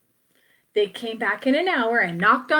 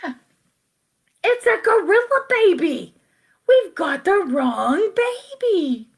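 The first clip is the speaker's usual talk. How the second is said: both parents shout in panic, much higher; yelling; 8 semitones higher than usual. Acted. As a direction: cry out, the voice climbing high. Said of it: "and they both yelled".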